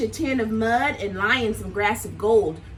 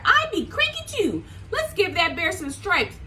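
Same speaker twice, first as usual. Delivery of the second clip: much higher, squeaky animal voice; Zebra voice; 6 semitones higher than usual. Acted. Zebra to the friends, high squeaky voice. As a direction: pipe up like a bright little zebra. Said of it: "said Zebra".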